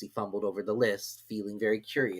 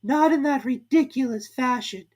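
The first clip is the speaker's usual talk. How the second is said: high, sulky squeak; high, sulky Dormouse voice; 14 semitones higher, 6 dB louder than usual. A narrator's reading. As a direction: sulk off like a small creature. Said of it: "very sulkily".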